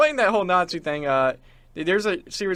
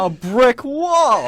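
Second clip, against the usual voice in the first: mock awe; 8 semitones higher, 9 dB louder, much slower than usual.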